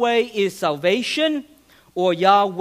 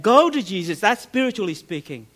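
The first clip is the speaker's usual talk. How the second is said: ordinary talk, nothing marked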